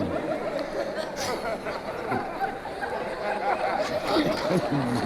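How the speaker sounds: high cackling laugh at own joke; long laugh. Real, not acted. Comic laughing at her own joke to the audience, high cackle.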